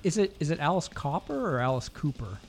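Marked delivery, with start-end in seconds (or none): none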